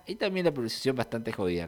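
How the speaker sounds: ordinary talk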